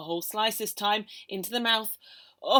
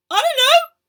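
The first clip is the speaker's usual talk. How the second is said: very high, loud squeak; squeaky denial; 18 dB louder than usual. A narrator's reading. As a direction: squeak it out guiltily.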